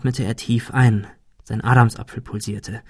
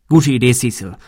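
motivated; 4 dB louder than usual. Acted. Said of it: "Arthur klang motiviert".

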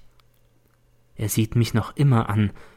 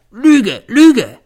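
much higher, louder cries of lie; furious shout; 12 semitones higher, 10 dB louder than usual. A narrator's reading. N screaming at Z, furious. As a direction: scream it back at him. Boys quarrelling.

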